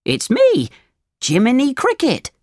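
high, perky little cricket; high-pitched storybook cricket. A narrator's reading. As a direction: announce yourself as a tiny cricket. Cricket introduces himself, story figure.

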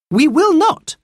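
put-on high, loud child's defiance; defiant. A narrator's reading. Anthea stands up to Golden Eagle, defiant. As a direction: refuse boldly, pitched right up.

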